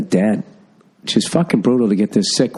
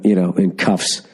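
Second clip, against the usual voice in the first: ordinary talk, nothing marked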